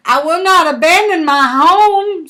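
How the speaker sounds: a small frog that won't let go; defiant frog. A narrator's reading. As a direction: dig in and refuse, raising the pitch. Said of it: "said the frog".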